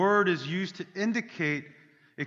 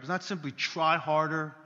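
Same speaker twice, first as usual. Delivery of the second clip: normal talking voice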